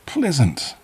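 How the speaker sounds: put-on high, creepy old man; a hint of a sinister old stranger. Dodman voiced by narrator.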